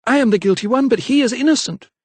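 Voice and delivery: high, loud begging; desperate plea. A narrator's reading. Mother begging the vicar to spare her son. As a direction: offer herself up.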